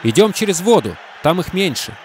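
loud, high warning shout. Acted. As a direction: call out over the roar. Shouting over race start. (громкий крик)

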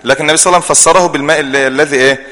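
normal talking voice

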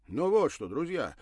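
loud, irritated call to the group; displeased. Acted. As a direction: snap at friends to get serious. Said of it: "услышала недовольный голос".

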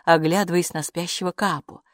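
usual speaking voice

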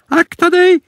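sharp high warning cry from grandma. Acted. Grandma warning Bamse off the cellar, high alarmed cry. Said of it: "varnade farmor".

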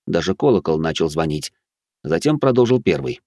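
normal talking voice

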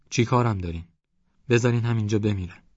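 usual speaking voice

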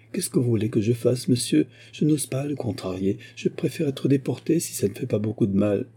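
voix nettement plus aiguë, jeune servante affolée; servante apeurée. A narrator's reading. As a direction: supplier vite, la voix montée d'effroi. La servante terrifiée supplie. (jeune servante terrifiée qui supplie le détective, voix aiguë)

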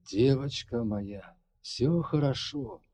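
hoarse whisper. Acted. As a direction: rasp it tenderly, slowly. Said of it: "хрипло шептал он".

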